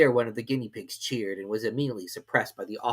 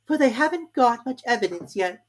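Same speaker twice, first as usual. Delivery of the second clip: Alice to herself; 13 semitones higher, 6 dB louder than usual. A narrator's reading. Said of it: "she said to herself".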